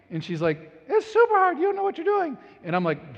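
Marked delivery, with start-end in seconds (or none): 0.9-2.4 high, loud mock wife voice; hammed-up high wife voice; imitating wife. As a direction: squeak it like his wife refusing. Husband voicing his wife.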